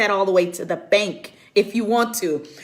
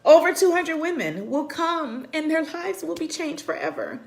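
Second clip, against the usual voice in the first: tearful; 5 semitones higher, 4 dB quieter than usual. Real, not acted. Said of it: "I'm just a puddle right now".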